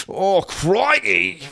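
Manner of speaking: slow, high mock Aussie "Oh, crikey"; stretched Australian caricature; Australian accent. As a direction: drag out a cartoon Australian gasp. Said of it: "mad Australian cousins".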